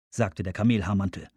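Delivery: ordinary delivery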